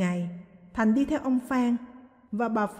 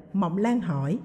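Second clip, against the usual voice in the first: usual speaking voice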